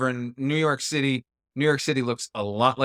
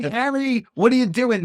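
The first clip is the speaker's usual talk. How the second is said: high, loud exasperated plea; mock exasperation; 10 semitones higher, 4 dB louder than usual. Host calling out to the film's hero over the old sandwich, mock exasperation. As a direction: scold him in mock disbelief. Mock scolding of film character.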